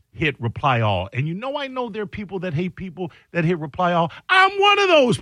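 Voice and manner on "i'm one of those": high, emphatic outburst; emphatic confession. Real, not acted. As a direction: declare it high and forceful. Heated confession about hating reply-all.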